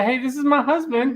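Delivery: lightly voiced wife; imitating wife. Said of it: "waiting for her to say".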